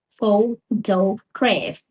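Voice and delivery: photograph said slowly with PHO stressed; first syllable stress. Shown as a demonstration. Teacher modelling the stress on PHO, slow. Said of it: "stress on the first syllable".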